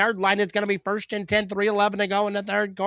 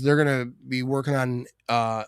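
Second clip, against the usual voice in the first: ordinary delivery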